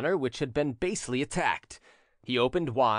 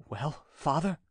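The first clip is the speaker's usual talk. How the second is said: hesitant, mildly fearful question; trembling question. A narrator's reading. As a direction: ask with a touch of trembling, rising at the end. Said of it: "asked tremblingly".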